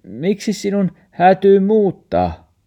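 grandma bewildered, high and loud; puzzled asking. Acted. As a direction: ask in disbelief why he must move. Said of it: "murfaari ihmetteli".

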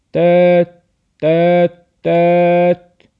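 high, loud honking; truck horn. Acted. The truck honks at Max, loud horn imitation. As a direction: honk like a big truck horn.

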